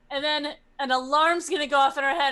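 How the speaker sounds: ordinary talk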